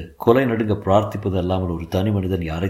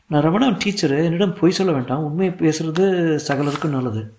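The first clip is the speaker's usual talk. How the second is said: higher-pitched lady teacher voice; 7 semitones higher than usual. A narrator's reading. Teacher questioning a pupil's father, lady teacher voice.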